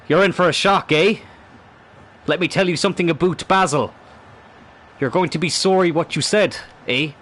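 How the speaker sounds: cartoonish Canadian taunt; terrible Canadian accent. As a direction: do a bad mock-Canadian accent. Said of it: "That was a terrible Canadian accent".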